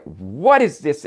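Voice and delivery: loud, high, slowed accusation; imitating a drunk, accusing mother; drunk mother's demand. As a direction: confront with a heavy punch on WHAT. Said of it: "like, a little drunk".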